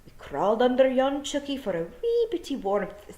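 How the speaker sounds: high, broad Scots wee-man voice; Scottish pictsie voice. A narrator's reading. Tiny Scots fairy man explaining to Tiffany, broad Scots. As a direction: chatter it like a tiny Glaswegian rogue. Nac Mac Feegle voice.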